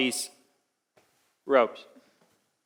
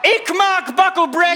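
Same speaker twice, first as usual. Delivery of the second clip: high, loud nonsense puppet cry; 13 semitones higher, 4 dB louder than usual. Acted. Tinker shows off the puppet voice, high puppet cry. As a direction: launch into a piercing puppet voice. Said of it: "I got a puppet voice".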